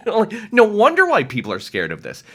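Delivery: high, loud laughing disbelief; laughing it off in amazement. Real, not acted. Coach laughing about scary inner work, amused.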